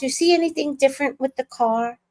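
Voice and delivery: coy, self-pleased mock-dad question; proud of himself. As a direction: play dad fishing for admiration. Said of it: "he was so proud of himself".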